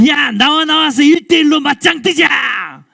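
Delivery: loud, high taunting shout; challenging Goliath. Acted. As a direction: bellow a challenge to single combat. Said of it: "하나님을 조롱하고 이스라엘의 군대를 위협한 거죠".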